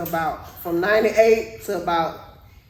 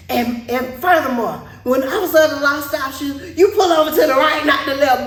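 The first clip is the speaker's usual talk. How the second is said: fast, raised, heated lecture; officer on ten; 5 semitones higher, 4 dB louder, faster than usual. Retold or imitated. Officer lecturing driver on pulling over, fast and heated. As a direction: rattle off a scolding like a worked-up officer. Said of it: "he was on ten the whole time".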